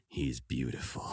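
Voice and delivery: low, hushed, slow admiration; doting sculptor. Retold or imitated. Sculptor gazing at his finished puppet face, hushed and doting. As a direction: imitate an artist adoring his own creation. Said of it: "sixty hours sculpting the face".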